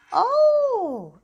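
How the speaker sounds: high loud gasp of an oh; sudden delighted oh; surprised. A narrator's reading. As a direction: caught by surprise. Tootle sees green flag.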